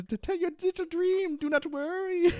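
very high mock English "darling"; hammed-up English wife; Penny's accent. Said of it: "this accent's really getting away from us".

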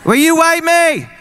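put-on high, slow child's wail; screaming kid. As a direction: whine it like a little kid waking dad. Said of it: "call me at four in the morning screaming".

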